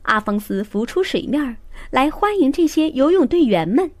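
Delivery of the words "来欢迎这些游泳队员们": plain reading voice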